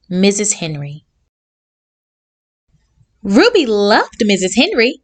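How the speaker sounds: ordinary delivery, nothing marked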